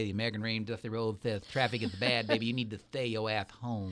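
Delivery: a hint of Dusty Rhodes cutting a promo; low, easy wrestling promo voice, only lightly put on; Dusty Rhodes impression. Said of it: "I'll just say".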